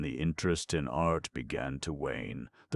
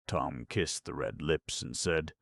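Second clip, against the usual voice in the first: plain reading voice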